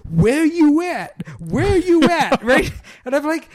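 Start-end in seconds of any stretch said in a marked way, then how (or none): 0.1-2.4 high, loud, drawn-out imitation of a movie yell; mock screaming. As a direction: imitate a character losing it and screaming at Bigfoot. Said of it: "starts screaming at Bigfoot".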